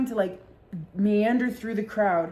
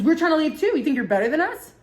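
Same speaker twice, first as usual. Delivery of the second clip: put-on snippy bar girl; 7 semitones higher, 4 dB louder than usual. Girl keeps picking a fight.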